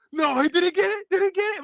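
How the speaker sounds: put-on high-pitched kid in disbelief; mock disbelief. Son finding no console among gifts, mock disbelief. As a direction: pitch it way up, playing the dramatic kid.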